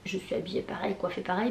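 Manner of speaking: softly, almost breathed; hushed. Real, not acted. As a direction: drop to a near whisper. Aside while filming.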